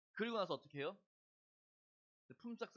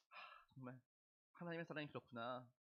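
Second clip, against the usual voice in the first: moved sigh; 3 semitones lower, 6 dB quieter than usual. Retold or imitated. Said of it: "슬슬 이제 눈물이 이제".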